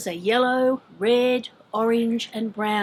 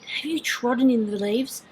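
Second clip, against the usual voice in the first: normal talking voice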